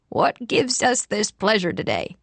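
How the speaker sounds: raspy ex-smoker's voice; scratchy-throated old man asking politely; rough old man voice. A narrator's reading. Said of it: "spoke in that rough voice that told of his smoking years".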